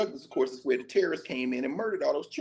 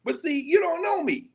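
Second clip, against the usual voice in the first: 1 defiant shout; 11 semitones higher than usual. Acted. Reciting defiant lines.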